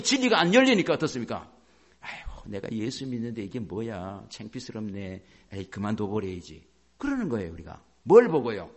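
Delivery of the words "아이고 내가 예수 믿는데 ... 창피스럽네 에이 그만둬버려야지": low, soft, dejected grumble; discouraged muttering. Acted. Preacher voicing a believer shamed by failing, low discouraged mutter. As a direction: give up under your breath, voice sunk low.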